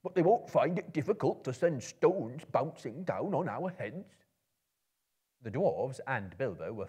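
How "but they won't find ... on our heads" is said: higher, louder grumbling dwarf; dwarf grumbles to Gandalf about stones; grumbling Bombur. A narrator's reading. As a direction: complain like a sour dwarf. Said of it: "grumbled Bombur".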